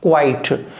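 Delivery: क्वाइट as one-beat "kwait", falling; correct pronunciation. Shown as a demonstration. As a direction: say क्वाइट with no vowel after k. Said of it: "क्वाइट नहीं बोलना".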